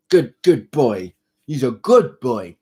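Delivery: slow praise for the cat; praising the cat. Real, not acted. Praising the kitten slowly for good behaviour.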